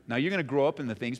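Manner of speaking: normal talking voice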